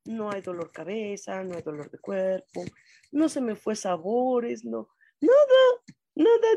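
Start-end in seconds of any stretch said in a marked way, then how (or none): 4.7-6.6 nada, nada squealed high and loud; emphatic high no. Real, not acted. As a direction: insist it, way up high. Insisting she lost no taste.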